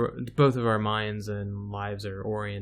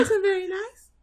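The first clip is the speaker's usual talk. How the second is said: very high squeaky put-on voice; squeaky cartoon voice; 6 dB louder than usual. Answering her SpongeBob bit in a very high squeak. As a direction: squeak it like a tiny cartoon villain.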